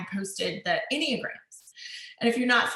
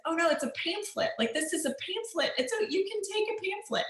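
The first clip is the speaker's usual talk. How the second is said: higher, dawning "oh no" of a mom who gets it; sudden realization; 9 semitones higher, 4 dB quieter than usual. Retold or imitated. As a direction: realize the mix-up and explain it gently to a toddler. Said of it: "and I said".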